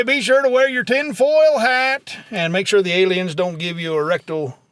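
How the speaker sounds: ordinary talk, nothing marked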